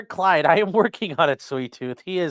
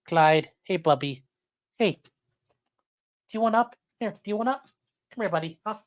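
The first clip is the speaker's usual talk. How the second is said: coaxing the dog; 3 semitones higher than usual. Real, not acted. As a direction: gently coax the dog up.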